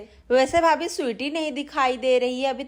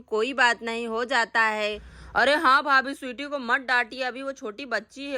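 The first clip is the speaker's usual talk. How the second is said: normal talking voice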